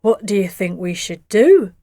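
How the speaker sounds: lightly voiced dad; dad trying not to smile; dad's voice. A narrator's reading. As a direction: ask holding back a smile. Dad asking, hiding smile.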